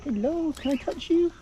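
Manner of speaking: high, slow coo at the rabbit; sweet pet-talk voice. Real, not acted. Greeting a rabbit at the farm.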